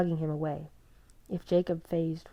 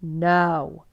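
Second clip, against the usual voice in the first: snarled; 9 dB louder than usual. A narrator's reading. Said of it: "Edward snarled".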